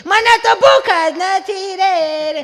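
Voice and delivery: put-on high, very slow whining child; drawn-out whiny child. Third child whining to grandma that he isn't hungry. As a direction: stretch it out like a sulking kid.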